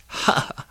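voiceless staccato chuckle; one last evil ha-ha; dry, breathy acted laugh; parting villain laugh. A narrator's reading. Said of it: "And with one last".

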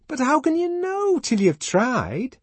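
high, loud, slow questioning; insistent challenge. A narrator's reading. Fanny pressing Lenina to try others, insistent. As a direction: demand an answer, stretching the words.

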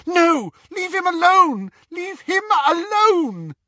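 high, loud desperate scream. A narrator's reading. Hermione pleads with attackers to leave him alone, screaming. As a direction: scream at them to stop. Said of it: "and Hermione screamed".

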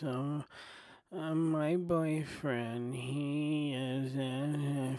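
mock a boring comic droning on stage; whiny monotone comic. Mocking a dull comedian's slow whiny delivery. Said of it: "comedians that talk like this".

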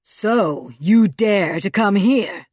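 loud, slow, menacing boom; booming angry voice. A narrator's reading. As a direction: boom it out threateningly. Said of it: "a loud and angry voice".

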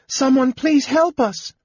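very high, pleading call; frightened cry. A narrator's reading. Woman begs anyone for rescue, frightened cry. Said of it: "Usha cried".

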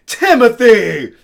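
high, loud imitated mother's yell; scolding mom. Mother catching her son out, imitated scolding shout. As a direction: stretch the name out and scold loudly. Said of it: "my mom was like".

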